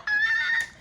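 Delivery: high, loud squeal of laughter; joyful shrieking laugh; delighted laugh. Real, not acted. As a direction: burst into a gleeful high laugh. Laugh after proud answer.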